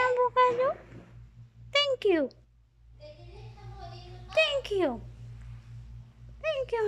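very high cooing voice. Real, not acted. High baby voice to pet.

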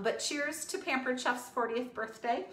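ordinary talk, nothing marked

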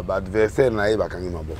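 usual speaking voice

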